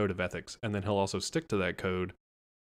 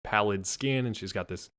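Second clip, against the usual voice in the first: usual speaking voice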